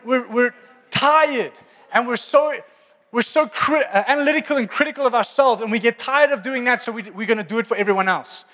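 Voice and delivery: loud, high, driving sermon delivery; fired-up preacher pushing the point; full-force preaching. Acted. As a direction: preach it out to the whole church.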